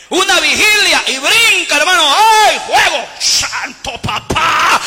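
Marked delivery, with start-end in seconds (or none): 1.8-4.8 mock fervour. Imitating a fired-up believer.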